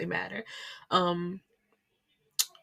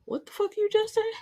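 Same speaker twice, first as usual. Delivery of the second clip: high-pitched mock shock; squeaked, incredulous retort; shocked disbelief. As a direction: act out a stunned double take. Acted shocked reaction to the son's news.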